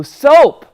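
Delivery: "soap" stated slowly with a clear S; target word. Shown as a demonstration. Host giving puppet the next word, clear "soap". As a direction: set out "soap" slowly and loudly. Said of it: "That was soap".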